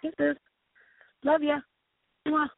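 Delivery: high, sweet little voice; cutesy affection. Real, not acted. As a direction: blow kisses to an absent fan. Blowing kisses to absent fan.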